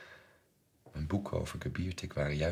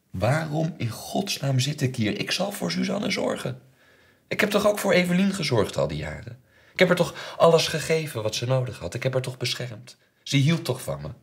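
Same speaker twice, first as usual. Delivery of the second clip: higher, louder, indignant outburst; anguished protest; 7 semitones higher, 7 dB louder than usual. Acted. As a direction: plead your case in rising outrage. Monologue, anguished protest of a father. (verontwaardigde, wanhopige uitbarsting)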